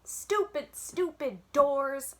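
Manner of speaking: high frustrated holler, stretching doors slowly; furious little kid holler. A narrator's reading. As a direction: yell at the locked doors. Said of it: "I hollered".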